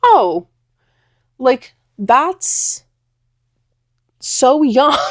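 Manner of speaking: high, tender coo over something small. Retold or imitated. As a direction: say it like cooing at a baby. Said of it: "and I was like".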